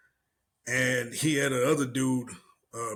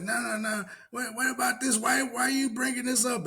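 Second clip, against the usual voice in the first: mock outraged woman; 10 semitones higher than usual. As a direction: whine it out, offended and shrill. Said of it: "She's like".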